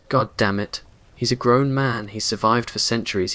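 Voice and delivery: plain reading voice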